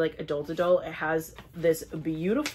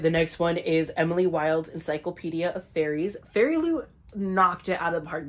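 usual speaking voice

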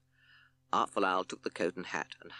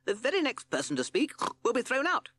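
cross, higher judge's snort; 4 dB louder than usual. Acted. Justice Pig scolds the court, cross and higher. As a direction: huff it out like an annoyed pig.

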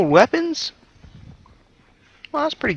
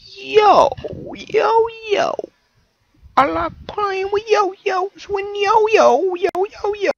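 silly high voice; 10 semitones higher, much slower than usual. Pretend. Goofing around with silly chant.

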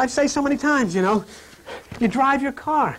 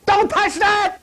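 much higher, louder panicked yell; pilot's yell; 11 semitones higher, 4 dB louder, slower than usual. Retold or imitated. Pilot yelling at the co-pilot, panicked. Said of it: "the pilot yelled at the co-pilot".

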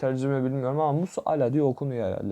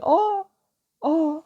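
shocked gasp; 5 dB louder than usual. Real, not acted. Shocked at a play.

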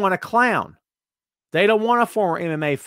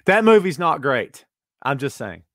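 ordinary talk

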